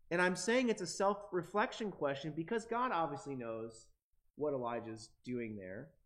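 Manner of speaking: ordinary talk, nothing marked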